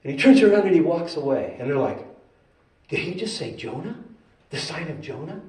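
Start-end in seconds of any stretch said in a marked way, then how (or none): 2.9-5.4 softly asked, baffled question; quiet, disbelieving query; hushed puzzlement. Acted. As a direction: confer under your breath, puzzled. Puzzled Pharisees confer after Jesus leaves.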